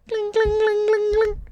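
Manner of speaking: high sung ding noises; high chime imitation. Shouting out the rap song, a high imitated chime. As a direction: mimic a bell ringing high.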